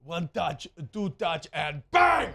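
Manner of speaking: building commentator call that ends in a big shout on bang!; imitating the announcer's call. Host recreates the goal call before the break, building to a big shout. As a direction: rise through the count and yell bang!. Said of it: "give me that second announcer again".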